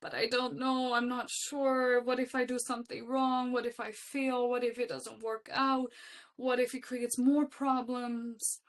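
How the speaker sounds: high, strung-out string of worries; mock anxious fretting. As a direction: imitate yourself fretting, pitch held up. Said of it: "I struggled so much with this".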